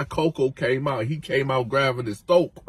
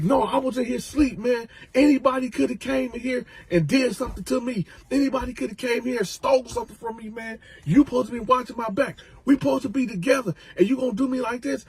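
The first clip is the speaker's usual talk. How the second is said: high complaint; 10 semitones higher, faster than usual. Retold or imitated. Freddy arguing.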